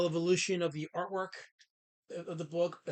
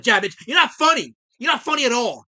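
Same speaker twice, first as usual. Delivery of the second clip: loud, higher teasing scold; mock scolding; 5 semitones higher, 11 dB louder than usual. Real, not acted. Streamer mock-scolding a teasing chatter, loud and high. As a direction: roast him at full volume.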